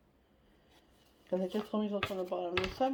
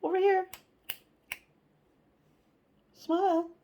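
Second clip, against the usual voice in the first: higher, louder, sing-song coaxing; coaxing a kid; 10 semitones higher, 3 dB louder than usual. Retold or imitated. Reader voices a parent coaxing a kid to smile. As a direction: call to a small child for a photo. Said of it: "with a kid".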